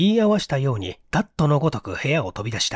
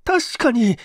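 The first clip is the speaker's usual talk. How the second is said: much higher, slow, fearful voice; trembling insistence; 13 semitones higher, slower than usual. A narrator's reading. Okamoto backing up Tazawa, trembling with fear. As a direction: plead the point while shaking. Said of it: "震えながら口を出した".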